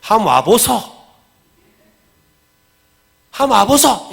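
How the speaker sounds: loud, high Gyeongsang dialect call. Shown as a demonstration. Preacher demonstrates Philip's invitation in Gyeongsang dialect. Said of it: "경상도 말로 하면 뭐예요?".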